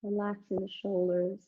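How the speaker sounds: soft, calm relaxation guidance; guided meditation. Acted. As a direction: ease them gently into rest. Leads Shavasana relaxation.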